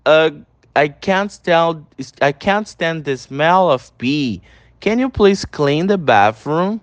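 slow, deliberate English, each word apart; slow teacher demo of the line; slow pronunciation model. Shown as a demonstration. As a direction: model it slowly for students to repeat. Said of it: "repete a pronúncia em todos".

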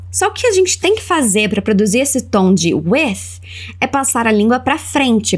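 normal talking voice

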